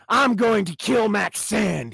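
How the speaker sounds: loud, slow, murderous declaration; yelled. Acted. Jimmy vowing to kill the stalker, loud and slow. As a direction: bellow it out slowly. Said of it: "he yelled".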